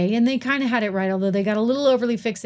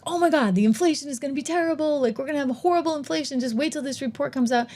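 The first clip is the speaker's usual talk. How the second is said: fast, higher whiny alarm; mock panic; 5 semitones higher, faster than usual. Host mocking inflation doomsayers, fast mock panic. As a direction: parody the doomsayers rushing their fears. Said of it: "everybody was like".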